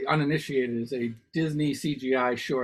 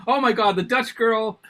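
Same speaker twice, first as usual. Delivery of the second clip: mock gushing; 7 semitones higher, 10 dB louder than usual. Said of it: "So I was like".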